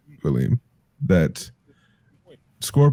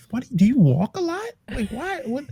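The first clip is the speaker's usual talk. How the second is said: incredulous, pitch climbing high; 4 dB quieter than usual. Real, not acted. Baffled at walking so much.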